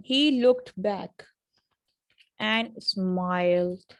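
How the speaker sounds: very slow, each word of "he looked back and smiled" separate; words in isolation. Shown as a demonstration. Teacher reading the sentence to learners, slow careful words. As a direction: stretch each word out fully, pausing before "and smiled". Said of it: "पहले words को isolation में बोलना".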